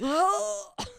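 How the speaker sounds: high, strange climax grunt; a silly high finishing groan; mock orgasm grunt. Imitating the hottest guy climaxing, high groan. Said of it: "they're just like".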